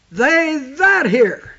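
slow with "that" held; stress on THAT. Shown as a demonstration. Preacher stressing the key word to the congregation. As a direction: weigh the word "that". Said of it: "that word "that" took on some importance".

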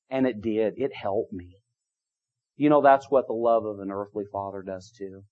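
usual speaking voice

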